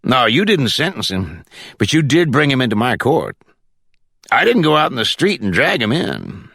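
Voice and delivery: mildly combative judge character; lightly voiced judge. A narrator's reading. Judge answering his visitor about his court, lightly voiced.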